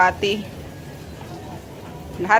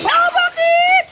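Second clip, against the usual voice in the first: much higher, cooing question; pet baby talk; slower than usual. Acted. Owner asking the pets what's wrong in a high coo. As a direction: ask the animals sweetly up high.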